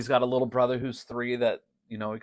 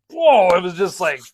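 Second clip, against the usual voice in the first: amazed whoa; 6 semitones higher, 12 dB louder than usual. Real, not acted. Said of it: "recalling amazement".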